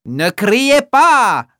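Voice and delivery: slow, loud, high spider voice; big spider exclaiming. Acted. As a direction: call out slowly as the spider. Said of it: "s'exclame une grosse araignée".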